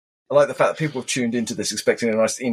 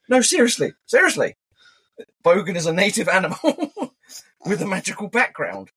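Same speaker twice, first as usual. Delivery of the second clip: mock earnest insisting; 7 semitones higher than usual.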